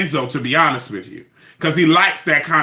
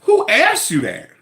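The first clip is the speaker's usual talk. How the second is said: mock indignation; 10 semitones higher than usual. Real, not acted. Mocking indignation.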